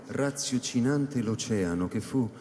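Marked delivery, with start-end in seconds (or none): none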